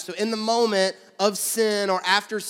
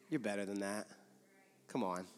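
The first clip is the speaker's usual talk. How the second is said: much softer and lower, encouraging; soft, lower voice of conviction; gentle encouragement; 8 semitones lower, 12 dB quieter than usual. Pretend. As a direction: coax them kindly, quietly.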